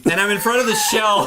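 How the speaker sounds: normal talking voice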